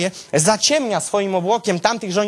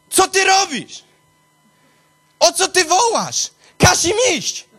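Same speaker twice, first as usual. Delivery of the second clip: God rebuking; 11 semitones higher than usual. Acted.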